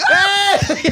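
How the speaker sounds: cheering hey. Retold or imitated. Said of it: "they'll be like".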